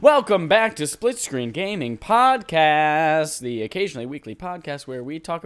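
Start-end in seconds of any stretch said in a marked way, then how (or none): 0.0-3.4 announcer intro. Acted.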